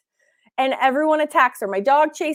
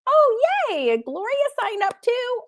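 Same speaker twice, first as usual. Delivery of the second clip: excited cheer; 9 semitones higher than usual. Real, not acted. Cheering another sign-up.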